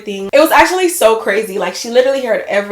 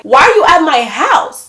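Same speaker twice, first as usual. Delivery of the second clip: louder, higher, slow demand; retold loud demand; 5 semitones higher, 5 dB louder than usual. Confronting her ex at her door, loud. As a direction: demand it slowly and loudly. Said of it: "I'm low-key getting loud".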